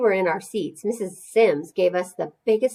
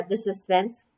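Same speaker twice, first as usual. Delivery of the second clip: usual speaking voice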